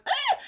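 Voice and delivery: high mock shriek of panic; a sudden high "Ah!" of alarm; panicked shriek. Panicking over missing moisturizer.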